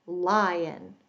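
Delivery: ordinary delivery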